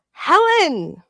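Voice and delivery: high, loud gasp at a name; delighted to spot her; thrilled gasp; gasping greeting. Real, not acted. Greets viewer in chat.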